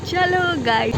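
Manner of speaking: loud, high sing-out; calling out. Real, not acted. Calling out to viewers on arrival. (ऊँची आवाज़ में पुकारते हुए)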